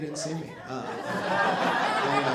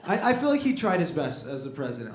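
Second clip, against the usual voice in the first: normal talking voice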